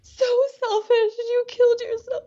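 very high, tear-choked cries; real crying rage at father; anguished sobbing. Real, not acted. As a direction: wail the accusation through sobs. Client confronting dead father.

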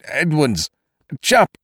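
high, startled, broken-off call; stammering. A narrator's reading. Kesselbach facing a pistol, calling his men, stammering. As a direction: stammer the names and cut short. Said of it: "Kesselbach stammered".

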